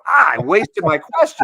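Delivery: loud, high cry of regret; exasperated outburst. Retold or imitated. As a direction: reenact kicking yourself out loud. Reenacts regret at blown question.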